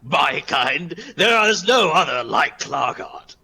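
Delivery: loud, slow, grand proclamation; boastful Clargard. Acted. Warrior with hands on hips, boastful. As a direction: declare it proudly, stretched out high. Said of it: "puts his hands on his hips".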